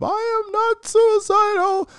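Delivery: high, loud, slow shrieked denial; hammed-up hysterical wail, pitched high; mock screaming plea. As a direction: screech it like a terrified man pleading. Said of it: "repeated Smollett impression".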